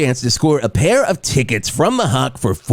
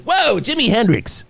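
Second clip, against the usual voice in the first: mock amazement; 9 semitones higher than usual. Listener quote on radio.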